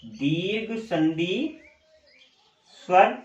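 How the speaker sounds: slow, word by word, as if writing; slow dictation. Real, not acted. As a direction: spell it out slowly for the class. Dictating while writing on board. (Board पर लिखते हुए धीरे-धीरे बोलना)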